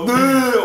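high imitated booing; crowd boo. Crowd after a bad pass, imitated boo. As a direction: boo like a whole grandstand. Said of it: "El abucheo".

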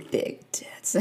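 whispered cheeky aside. Real, not acted. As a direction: drop to a whisper.

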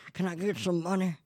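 high-pitched mock begging; imitated panhandler. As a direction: put on a pleading panhandler voice. Imitating beggar asking passers-by.

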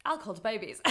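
ordinary delivery

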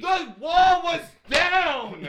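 high, loud, stretched-out cry; drawn-out yell of frustration; exasperated yell. Real, not acted. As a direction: shout it in comic despair. Yelling in frustration at scene.

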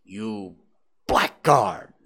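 furious build from low to loud; outraged shout at a scoundrel. A narrator's reading. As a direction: hold back, then explode on the insult. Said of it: "I shouted".

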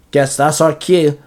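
plain reading voice

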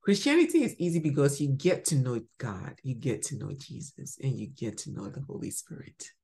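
usual speaking voice

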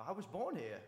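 imitated English-Australian-sounding accent. Woman at a bar insisting, English-Australian-like accent. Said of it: "a English or Australian accent".

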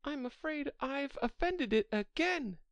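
high-pitched, regretful Alice; sorrowful. A narrator's reading. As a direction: lament it in a high character voice. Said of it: "cried Alice in a sorrowful tone".

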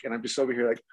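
usual speaking voice

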